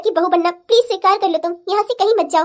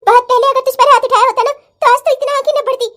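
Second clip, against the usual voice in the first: angry scolding; 4 semitones higher, 4 dB louder than usual. Acted. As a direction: bite each word out at her. Mother berates daughter after slapping.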